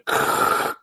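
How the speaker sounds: harsh whooshing burst of noise; imitated burner roar. Guest showing how a balloon burner sounds, imitated roar. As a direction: imitate a hot air balloon's flame blasting. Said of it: "just blew their speakers there".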